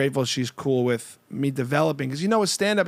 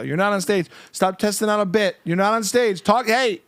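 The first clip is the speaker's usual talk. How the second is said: raised, higher nagging voice; scolding wife; 6 semitones higher, 4 dB louder than usual. Retold or imitated. Comedian as his wife stopping him testing bits, scolding. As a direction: cut him off like an exasperated spouse. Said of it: "She does that to me a lot".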